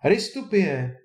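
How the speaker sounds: calling out. A narrator's reading. Boy calling at a bird by the puddle, calling out. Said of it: "koukal na ptáka u louže a volal".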